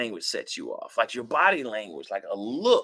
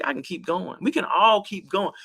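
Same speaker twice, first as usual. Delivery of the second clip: ordinary talk, nothing marked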